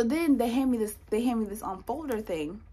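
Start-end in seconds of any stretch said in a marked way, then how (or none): none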